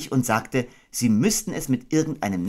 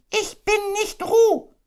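very high, squeaky insistent cry; loud Piglet; 4 dB louder, slower than usual. Acted. Piglet insisting to Kanga he isn't Roo, loudly. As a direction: protest loudly as a tiny pig. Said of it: "sagte Ferkel laut".

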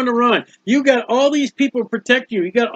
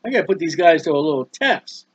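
hushed inner voice; 5 semitones lower, 4 dB quieter than usual. Retold or imitated. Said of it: "he's like, goes there".

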